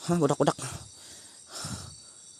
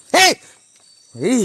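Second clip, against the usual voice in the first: sharp loud yell, pitch jumping up; shouting hey; 10 semitones higher, 9 dB louder than usual. Real, not acted. Hiker shouting at unseen kids in the forest, loud yell. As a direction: yell to stop someone in their tracks.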